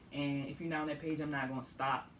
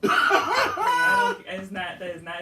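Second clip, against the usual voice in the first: laughing; 11 dB louder than usual. Real, not acted. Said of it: "laughing while answering".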